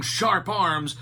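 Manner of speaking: ordinary delivery, nothing marked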